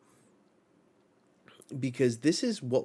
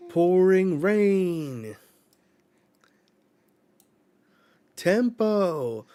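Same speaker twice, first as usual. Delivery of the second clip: high, loud and slowly drawn-out move call; calling out an attack; 8 semitones higher, 8 dB louder, much slower than usual. Retold or imitated. Nami summoning rain, calling out her attack loudly. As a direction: stretch each word like a battle cry. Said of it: "she goes".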